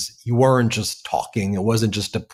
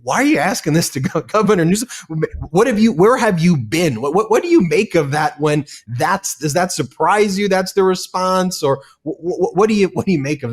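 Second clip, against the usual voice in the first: stunned mock reply, pitched high; incredulous; 9 semitones higher than usual. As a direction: throw their question back in disbelief. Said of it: "And I'm like".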